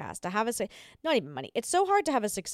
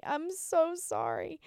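high, soft and slow, voice cracking; 7 semitones higher, 6 dB quieter, slower than usual. Real, not acted. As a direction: let the voice crack on sorry. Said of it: "I'm actually losing my voice".

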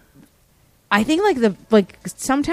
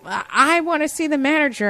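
mock demand, pitched up high; demanding customer; 7 semitones higher than usual. Customer demanding the manager from the clerk, mock indignant and high. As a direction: imitate a shopper insisting.

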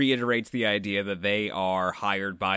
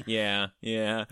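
ordinary talk

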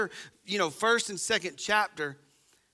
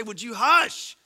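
louder scolding parent; exasperated parent; 5 semitones higher, 3 dB louder than usual. Retold or imitated. Parent fed up with a sarcastic kid, scolding. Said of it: "wanna smack 'em and say".

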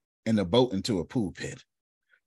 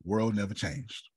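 normal talking voice

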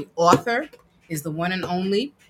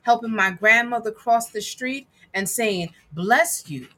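put-on reading to children; 4 semitones higher than usual. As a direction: read it proudly to a child. Reads picture book aloud.

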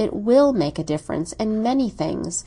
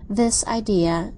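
ordinary delivery, nothing marked

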